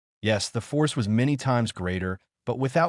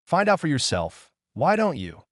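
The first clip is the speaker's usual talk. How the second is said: sniffy; 6 semitones higher than usual. A narrator's reading. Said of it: "his prisoner sniffed".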